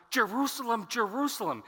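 JERUSALEM said twice, high and falling; emphatic repeated name. Acted. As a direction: call "Jerusalem, Jerusalem" high to get attention. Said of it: "It is an emphatic use".

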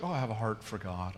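put-on sweetness, hushed; mock sweet. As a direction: coo it softly, as a sweet pious platitude. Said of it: "that sounds so sweet".

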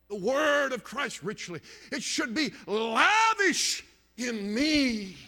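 high, loud, long-stretched key words; emphatic preaching. Real, not acted. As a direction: swell into a slow pulpit cry. Preaches at full force.

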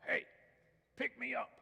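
high, squeaky little frog voice; high-pitched cartoon frog; talking frog. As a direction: call out as a tiny frog. Talking frog calls from pond.

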